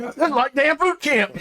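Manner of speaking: high mock complaint; exasperated guard. Guard complaining about the orders, high mock whine. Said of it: "He's like".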